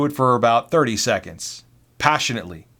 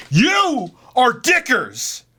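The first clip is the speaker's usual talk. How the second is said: slow, much higher shouted nickname; mock yell; 13 semitones higher, 4 dB louder, much slower than usual. Coworker yelling a nickname at the new hire, hammed-up shout. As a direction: bellow the nickname, drawn out. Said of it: "He continues on and goes".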